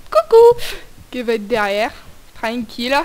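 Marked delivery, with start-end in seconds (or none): 0.1-0.6 mock monster greeting.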